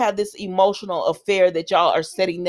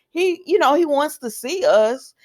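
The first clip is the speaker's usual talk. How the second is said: ordinary talk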